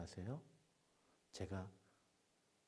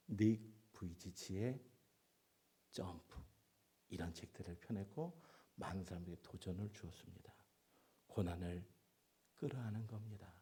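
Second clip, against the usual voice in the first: ordinary talk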